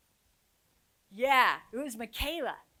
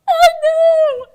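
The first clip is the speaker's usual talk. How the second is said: much higher, loud crying shout; joyful tearful outburst; 24 dB louder than usual. Retold or imitated. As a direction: burst out, sobbing with joy. Student bursts out crying joyfully.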